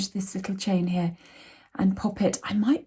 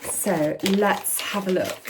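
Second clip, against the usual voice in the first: usual speaking voice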